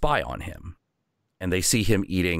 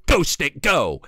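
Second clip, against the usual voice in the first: higher, louder shout of a command; mock command; 7 semitones higher, 4 dB louder, slower than usual. Northwind showing the kid how to command the stick, a mock shout. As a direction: call the stick into battle.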